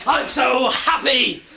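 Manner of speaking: high, loud, slow joyful shout; ecstatic shout. Acted. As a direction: stretch it out in pure joy. Celebrates winning the lottery.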